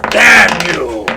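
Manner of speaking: loud, drawn-out dramatic curse; angry curse. Acted. Adventurer curses as the guards gain, loud angry cry. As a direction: cry it out in hammed-up anger.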